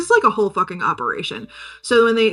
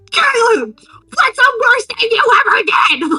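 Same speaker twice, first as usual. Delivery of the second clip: high, loud, pushy demand; a hammed-up squeaky yelled question; mock high yell; 13 semitones higher, 6 dB louder than usual. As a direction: shout it up high like a pushy interrogator. Acted quote of friend questioning.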